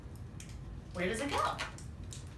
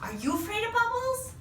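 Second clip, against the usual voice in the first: excited; 10 semitones higher than usual. Real, not acted. Talking to the dog.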